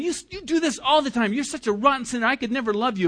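high, needling scold; mock accusing inner voice. Preacher voices the inner accuser to the congregation, mock contempt. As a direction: play the nagging voice in your head, lightly sneered. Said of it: "if you hear that voice in your mind".

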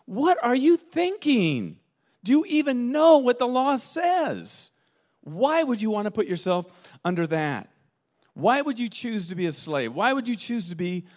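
incredulous. Retold or imitated. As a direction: stretch each word in disbelief. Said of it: "And Paul says".

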